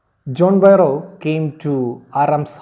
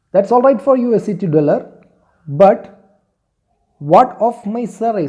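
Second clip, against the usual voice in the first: mock angry retort, higher and louder; retold anger; 7 semitones higher, 6 dB louder than usual. Teacher voices farmer protesting about his cart, mildly angry. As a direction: act out an indignant protest, raised voice. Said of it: "John Barrow was also angry".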